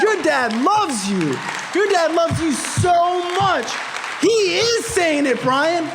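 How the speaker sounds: high, slow, emphatic pleading; impassioned insistence. Retold or imitated. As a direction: hammer it home word by word. Friend drives the message home.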